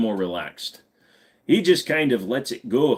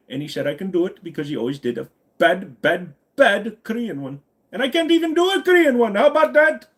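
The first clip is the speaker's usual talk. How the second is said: put-on Indian accent rising to a high finish; playful Indian accent climbing in pitch; 6 semitones higher than usual. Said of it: "I'm trying to do the accent".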